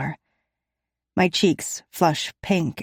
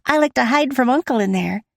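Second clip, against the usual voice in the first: put-on child voice; 7 semitones higher, 4 dB louder than usual. A narrator's reading. Sasha continues, child voice.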